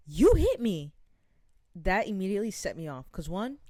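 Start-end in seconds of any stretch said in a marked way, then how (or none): 0.1-0.9 accusing quote. Retold or imitated. Lady accuses her after scrape.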